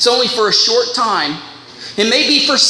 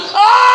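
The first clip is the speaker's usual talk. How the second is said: high loud scream of agony; hammed-up howl of pain; 12 semitones higher, 5 dB louder than usual. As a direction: cry out as if burning. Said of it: "that gold would be going".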